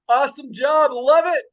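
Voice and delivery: ordinary talk, nothing marked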